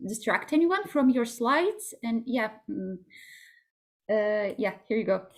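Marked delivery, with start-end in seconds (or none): none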